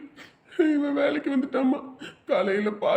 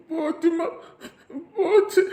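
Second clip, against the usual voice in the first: wailing; 3 semitones higher than usual. Acted. Son wails he lost everything.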